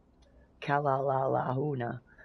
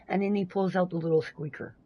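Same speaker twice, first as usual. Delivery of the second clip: ordinary delivery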